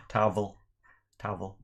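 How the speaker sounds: "towel" said "tavel" with a soft W V blend; Indian W V towel. Retold or imitated. Teasing his wife by mimicking her soft W V "towel". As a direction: lightly imitate the Indian "towel". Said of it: "with an Indian accent".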